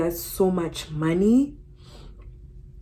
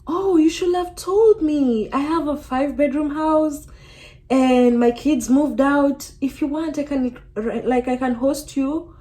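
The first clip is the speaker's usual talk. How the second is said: kind supervisor; 6 semitones higher than usual. Retold or imitated. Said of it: "she was just like".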